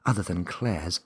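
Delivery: ordinary delivery, nothing marked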